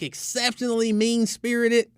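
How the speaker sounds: usual speaking voice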